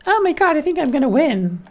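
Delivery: very high-pitched, thrilled burst; excited squeal. Real, not acted. Player about to win, squealing with excitement.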